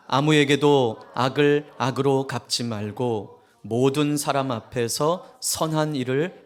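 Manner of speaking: slow, solemn reading of the verse; scripture reading. Acted. Preacher leading the congregation in reading Romans, slow and solemn. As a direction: read it out together, slow and solemn.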